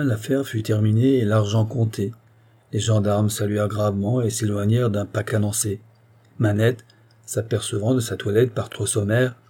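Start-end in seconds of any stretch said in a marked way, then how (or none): none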